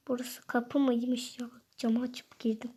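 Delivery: soft unvoiced whisper; sneaky aside about climbing in. Sneaking in through window.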